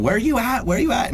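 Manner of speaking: high, puzzled calling out; confused calling. Acted. Player searching for a lost teammate.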